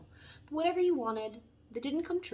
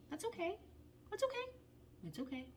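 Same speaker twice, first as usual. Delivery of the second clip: higher, softer, gentle reassurance; soft high okay; 9 semitones higher, 10 dB quieter than usual. Shown as a demonstration. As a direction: try to lift the voice on okay softly. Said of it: "My voice won't even go up".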